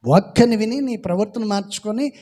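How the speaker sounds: loud, rising pulpit exhortation, hammering each phrase; preaching at full force. Acted. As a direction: drive the point home to the congregation.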